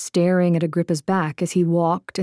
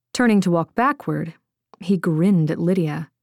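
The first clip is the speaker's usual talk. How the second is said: usual speaking voice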